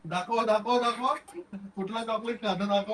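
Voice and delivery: high, soft, cooing voice for a little niece. Real, not acted. Uncle catching his niece eating chocolate, cooing high and soft.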